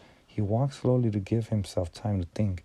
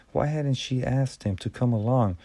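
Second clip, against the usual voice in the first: plain reading voice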